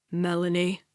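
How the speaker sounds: low, restrained growl. A narrator's reading. Captor warns the sister who stepped in, low growl. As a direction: growl it deep but held back. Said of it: "he growls but looks conflicted".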